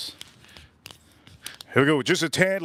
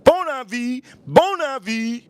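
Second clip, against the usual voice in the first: mock French accent, higher and louder; 9 semitones higher, 3 dB louder, slower than usual. Fake French accent greeting the viewers. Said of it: "You know, the French".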